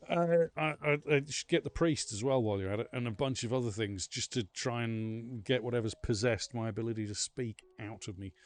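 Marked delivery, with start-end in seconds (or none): none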